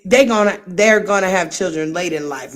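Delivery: usual speaking voice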